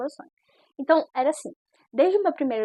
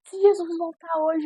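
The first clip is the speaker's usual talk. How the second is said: high, louder warning with JESUS stressed; pleading pastor; 6 semitones higher, 5 dB louder than usual. Retold or imitated. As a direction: urge her as the tearful pastor, leaning on Jesus. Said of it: "Ela falou assim". (pastora aflita alertando, voz aguda)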